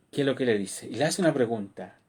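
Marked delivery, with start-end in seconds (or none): none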